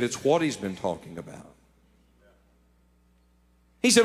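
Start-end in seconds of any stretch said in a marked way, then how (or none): 0.0-1.5 lightly voiced tearful wife, soft and low; a hint of a wife holding back tears; quiet, near-tearful confession; crying wife. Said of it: "And she started crying".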